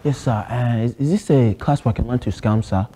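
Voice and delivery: put-on lightly held Nigerian accent, earnest student; a Nigerian student pleading with the teacher. To a geography teacher, a mild Nigerian accent. Persona asks security class.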